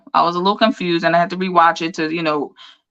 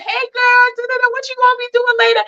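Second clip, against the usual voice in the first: flirty guy voice. Retold or imitated.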